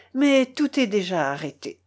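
ordinary delivery, nothing marked

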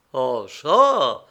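loud, indignant exclamation; exclaiming. A narrator's reading. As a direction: cry out in sudden protest. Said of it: "s'écria le prieur".

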